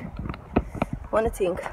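slow unvoiced whisper of "I wanna think". Real, not acted. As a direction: breathe "I wanna think" out slowly, voiceless.

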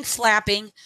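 ordinary delivery

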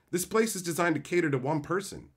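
ordinary talk, nothing marked

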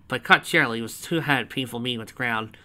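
ordinary delivery, nothing marked

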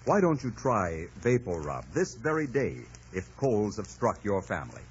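normal talking voice

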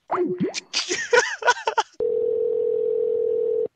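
cracking up, high and breathy; high burst of laughter. Real, not acted. Prank caller cracks up at the owner's rage, high laugh. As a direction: let the laugh break out high.

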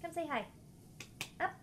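high, sing-song coaxing of a cat. Real, not acted.

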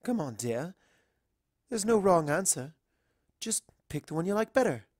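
high, reassuring and encouraging; Rarity coaxing. Acted. Rarity coaxing the frozen Twilight, high and reassuring. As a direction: gently push her to choose.

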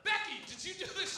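high-pitched mock interrogation; imitated accusing girl. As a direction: confront your friend as an indignant girl. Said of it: "immediately they're on her".

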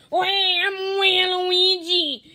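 high, loud and drawn-out cartoon villain voice; Waluigi voice. Vlogger shows his Waluigi idea, high loud hammed-up voice. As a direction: ham up a nasal-free goofy rival. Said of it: "here's my idea for Waluigi".

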